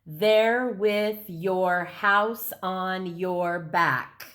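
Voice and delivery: "There with your house on your back" in seven slow, level beats; "There with your house on your back" stretched evenly, each syllable separate; counted-out syllables. Shown as a demonstration. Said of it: "Let's count and see if this is seven".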